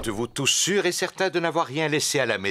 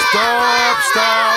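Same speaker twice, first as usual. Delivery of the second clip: sharp high call to halt the game; calling out stop; 10 dB louder than usual. Acted. Referee daddy stopping the children's quarrel, loud call. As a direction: cut through the argument firmly.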